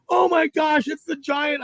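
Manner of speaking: high-pitched excited gushing; amazed imitation. Partner marvels at his giant fish, excited. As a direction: imitate an angler still in awe.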